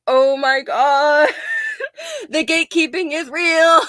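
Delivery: a drawn-out, laughing eye-roll of a line; mock exasperation. Retelling her reply to a goth gatekeeper, with mock exasperation. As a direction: roll your eyes and stretch out the Oh my God. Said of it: "And I'm just like".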